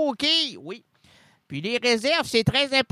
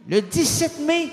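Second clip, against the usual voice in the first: "Le dix-sept mai" high and loud; "Le dix-sept mai" proclamé haut et fort; proclaimed; 7 semitones higher, 4 dB louder than usual. Shown as a demonstration. Loud take after whisper.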